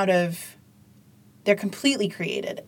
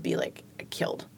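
whispered; 9 dB quieter than usual. Shown as a demonstration. Whisper after huguan.

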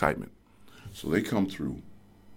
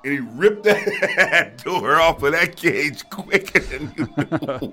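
laughing; 5 semitones higher, slower than usual. Real, not acted.

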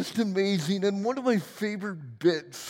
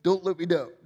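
usual speaking voice

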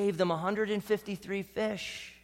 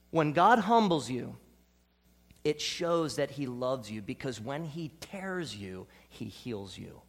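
ordinary talk, nothing marked

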